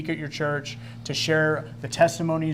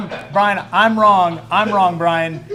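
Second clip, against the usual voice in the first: higher, much louder admission of fault; loud playful apology; 4 semitones higher, 10 dB louder, much slower than usual. Real, not acted. Host apologizing to Brian after teasing him, loud and playful. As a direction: call it out loudly across the room.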